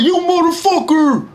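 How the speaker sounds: "You motherfucker!" high and slow with a different timbre; C-Town curse. Shown as a demonstration. Showing the C-Town way to say it, drawn out high. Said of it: "That's exactly how you say it".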